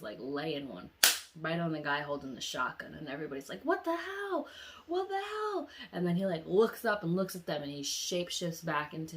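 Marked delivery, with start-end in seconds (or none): 3.6-5.7 high, loud cry of disbelief; shocked outcry. Retold or imitated. Everybody seeing him attack, shocked high outcry. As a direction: exclaim it high, aghast.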